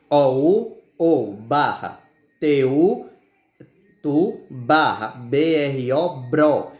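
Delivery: slow dictation, long pauses between syllables; spelled by syllables. Shown as a demonstration. Teacher dividing "outubro" for pupils, slow and deliberate. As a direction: spell each piece, then sound it out.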